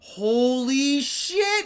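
hammed-up stunned reaction; mock astonishment. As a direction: draw it out in pretend amazement. Acting out mock astonishment at bartender.

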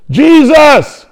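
high, loud, drawn-out plea. Acted. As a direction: cry out to be heard over the crowd. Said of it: "He got louder".

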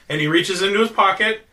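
ordinary delivery

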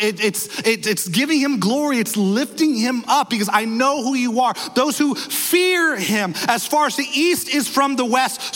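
ordinary talk

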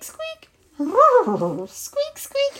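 tiny high-pitched mouse squeaks; imitated squeak. Imitates shrinking to mouse size.

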